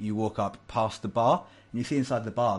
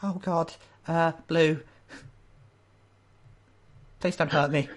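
high, hesitant pleading; nervous old lady; 8 semitones higher, 3 dB louder than usual. Acted. Old lady being questioned by security, scared and pleading. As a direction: stammer out an answer as if cornered. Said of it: "looking at you very nervously".

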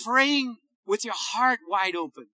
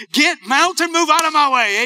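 loud, raised-pitch order; forceful command; 3 semitones higher, 6 dB louder than usual. Retold or imitated. Preacher shows how he spoke to the mountain, forceful command. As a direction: command it to move with full voice. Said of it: "begin to speak to that mountain".

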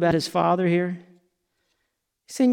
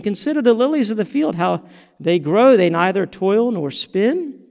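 scripture reading; 7 semitones higher than usual. Acted. Reads scripture with raised voice.

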